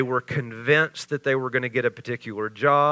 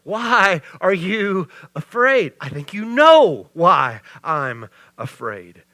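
slow, louder sarcastic "Why are you afraid?"; sarcastic retort; 3 semitones higher, 6 dB louder than usual. Retold or imitated. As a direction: throw the question back, dry and pointed. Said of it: "inserts his sarcasm".

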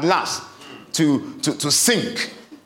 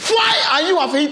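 higher, pressing voice with a laugh in it; anxious Jesus; 7 semitones higher than usual. Acted. Preacher showing the angst in Jesus' voice to the congregation, higher and pressing. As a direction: let the worry push through the question. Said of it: "sense the angst in Jesus' voice".

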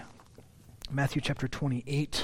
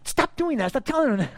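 much higher mock scolding; scolding in-laws; 11 semitones higher, 6 dB louder, faster than usual. In-laws telling the pastor to stop teasing their dog, mock scolding. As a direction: play the fed-up in-laws scolding. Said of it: "they're like".

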